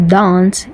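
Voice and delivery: "dance" with a broad "ah", like "dahnce"; long back a in "dance". Shown as a demonstration. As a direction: say "dance" the Queen's English way. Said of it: "R P pronunciation".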